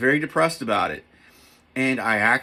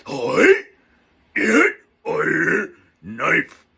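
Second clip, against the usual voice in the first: loud mock samurai shouts, higher pitched; hammed-up warrior barks; 6 semitones higher, 7 dB louder, much slower than usual. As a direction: punch out each word like a movie samurai. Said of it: "that's my samurai right there".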